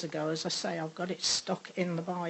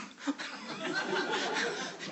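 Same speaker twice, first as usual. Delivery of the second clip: embarrassed laugh; 7 dB quieter than usual. Real, not acted. Laughs at her own outburst.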